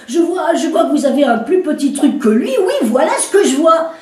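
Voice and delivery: woman mocking the commissaire's size; mocking laugh. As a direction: tease him through a laugh.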